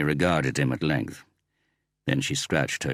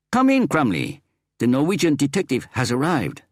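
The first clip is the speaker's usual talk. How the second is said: high, loud announcing chief; cheerful chief; 10 semitones higher, 4 dB louder than usual. A narrator's reading. The chief presents the Norwegian detective to Crumley, high and loud. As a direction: announce him proudly, high.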